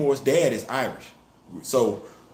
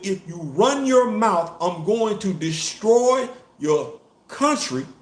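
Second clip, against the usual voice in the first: threatening quote; 7 semitones higher, slower than usual. Retold or imitated. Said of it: "told Ramunda".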